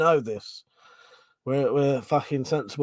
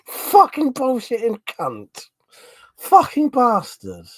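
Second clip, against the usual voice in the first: higher, slow, loud swearing; outraged ranting; 9 semitones higher, 6 dB louder, slower than usual. Real, not acted. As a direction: rage at the app, drawn out. Raging at translator.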